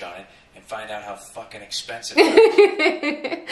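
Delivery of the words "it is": high, loud laughing punchline; shrieking with laughter; laughing shout. Real, not acted.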